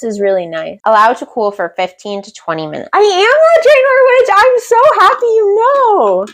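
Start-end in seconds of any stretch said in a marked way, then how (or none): 2.9-6.3 high, airy, delighted celebrity voice; flattered diva voice. Pretend. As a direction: gush like a flattered star.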